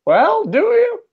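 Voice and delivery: excited. Acted. Pressing teammate for answer.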